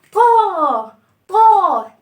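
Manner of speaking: طاء loud with a wide open aa. Shown as a demonstration. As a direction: open طاء fully for the kids. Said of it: "يردد وراها".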